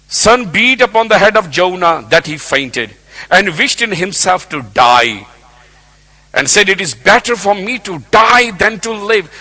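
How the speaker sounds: high, loud preacher's build to a peak; full-force preaching. Acted. Preacher retelling Jonah's despair, loud and high. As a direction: preach it hard and rising.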